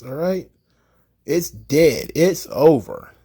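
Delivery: ordinary talk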